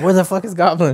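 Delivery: high, exasperated question; baffled gamer. Retold or imitated. Hiko falling for the goblin callout, mock bewildered. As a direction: imitate a gamer demanding the location.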